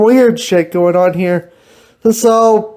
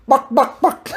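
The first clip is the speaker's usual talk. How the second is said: laughing chicken clucks; 3 semitones higher than usual. Pretend. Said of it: "I think I killed him".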